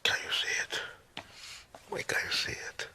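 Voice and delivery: hushed, intense whisper; exasperated whisper. Acted. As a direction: breathe it out, pleading for understanding. Dictator's quiet exasperation after outburst.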